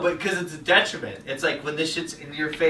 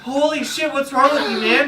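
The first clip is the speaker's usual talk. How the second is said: incredulous; 10 semitones higher, 4 dB louder than usual. Retold or imitated. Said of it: "And I was just like".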